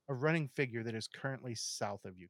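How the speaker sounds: normal talking voice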